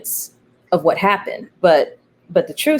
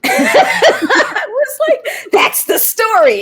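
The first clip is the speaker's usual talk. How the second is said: laughing; 5 dB louder, slower than usual. Retold or imitated. Laughing retelling own reply.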